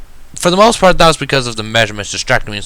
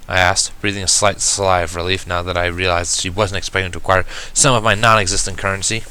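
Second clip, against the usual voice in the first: plain reading voice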